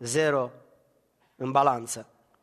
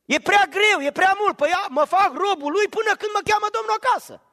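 high, loud, impassioned preaching; fervent pulpit cry; preaching at full force; 10 semitones higher, 4 dB louder than usual. Acted. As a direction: drive the congregation to the peak.

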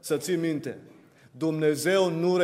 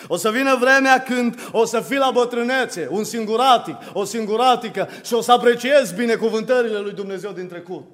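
urgent, raised pulpit voice; impassioned preaching; 6 semitones higher, 5 dB louder than usual. Real, not acted. Preacher warns the ungrateful congregation of hard times ahead, impassioned preaching. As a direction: press the warning on the listeners.

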